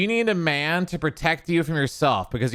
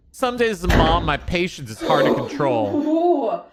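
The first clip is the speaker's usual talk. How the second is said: mock TikTok mom; 5 semitones higher, 4 dB louder than usual. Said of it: "And action".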